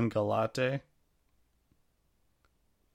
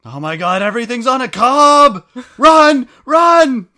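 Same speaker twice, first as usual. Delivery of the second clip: hammed-up panicked yell, much higher and louder; mock panic; 14 semitones higher, 18 dB louder than usual. Co-host fleeing corn puns in mock terror, hammed-up screaming.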